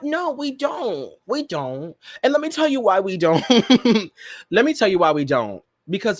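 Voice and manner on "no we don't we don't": slow, high and soft; drawn-out refusal. Acted. Host teasing her co-hosts, slow and high. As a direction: stretch it out teasing.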